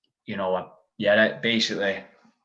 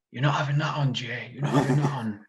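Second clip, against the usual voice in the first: lightly voiced coach, higher and a bit apart from his own voice; a hint of the other coach, teasing and firm; coach's objection; 4 semitones higher than usual. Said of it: "he's like".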